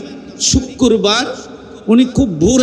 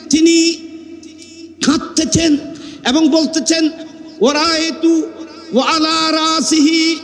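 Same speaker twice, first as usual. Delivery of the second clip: high, chanted sermon in measured phrases; melodic preaching; 6 semitones higher than usual. Acted. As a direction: hold each phrase on a high reciting note. Preacher intones the dream.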